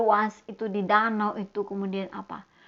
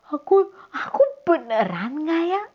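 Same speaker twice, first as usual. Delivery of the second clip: hesitant doubt; 6 semitones higher, 6 dB louder than usual. Acted. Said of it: "Merasa bimbang atau ragu".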